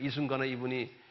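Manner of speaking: ordinary talk, nothing marked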